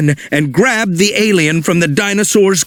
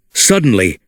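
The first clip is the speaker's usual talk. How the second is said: ordinary delivery, nothing marked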